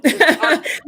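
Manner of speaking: bright burst of laughter; real laugh. Real, not acted. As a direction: laugh out loud, delighted. Laughing at the hug comment.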